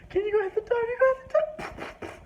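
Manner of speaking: hammed-up wife begging him to hit the dog; mock pleading wife.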